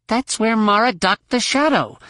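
high-pitched growled complaint; growled protest. A narrator's reading. Young pilot objects to changing course, growling. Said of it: "she growled".

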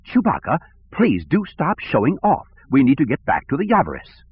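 clipped, metallic droid voice, pitched high; high-pitched fussy robot interrupting; C three P O. A narrator's reading. Droid interrupting to scold a roaring Wookiee, clipped metallic voice. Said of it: "The clipped metallic voice of C three P O interrupted".

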